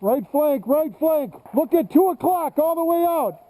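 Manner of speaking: high urgent shout across the field; shouted callout. Real, not acted. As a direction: yell the enemy position. Calling enemy positions in firefight.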